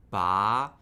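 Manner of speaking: loud "ba" steadily rising from mid pitch; firm "ba" climbing smoothly; second tone. Shown as a demonstration. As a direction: slide "ba" upward like a question. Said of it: "steadily rising".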